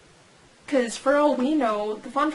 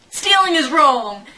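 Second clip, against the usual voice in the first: loud, higher scolding catchphrase; mock scolding; 4 semitones higher, 8 dB louder than usual. Joking answer to why they're in prison, loud scolding. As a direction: belt it out as a mock reprimand.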